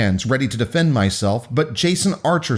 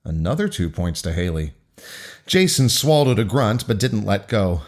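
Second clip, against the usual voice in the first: ordinary delivery, nothing marked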